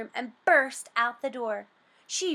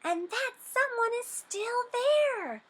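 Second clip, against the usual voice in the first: much higher, squeaky cub voice; 13 semitones higher than usual. A narrator's reading. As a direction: squeak like a startled little cub. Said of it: "squeaked Baby Bear".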